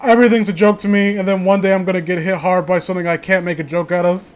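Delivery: ordinary talk, nothing marked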